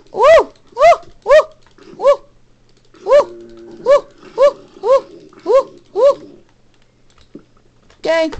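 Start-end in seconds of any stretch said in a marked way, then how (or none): 0.1-6.4 short, loud, high-pitched "woo"; repeated high whoops. Real, not acted. Player signing off the episode, whooping loudly. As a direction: whoop sharply over and over.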